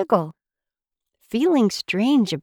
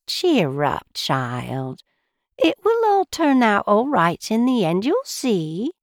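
sympathetic. A narrator's reading. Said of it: "the teapot said sympathetically".

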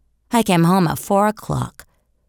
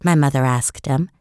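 ordinary delivery, nothing marked

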